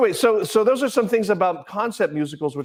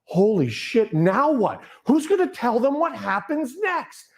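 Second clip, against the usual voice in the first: high, stunned disbelief; shocked outburst; 12 semitones higher, slower than usual. Retold or imitated. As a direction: blurt it out in sudden shock. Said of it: "and I was like".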